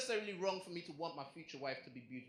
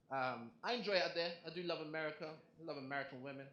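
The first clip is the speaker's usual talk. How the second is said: ordinary delivery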